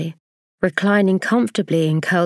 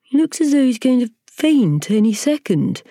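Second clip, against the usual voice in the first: centipede remark; 7 semitones higher than usual. Acted. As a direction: toss it off to the others. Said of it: "the centipede said".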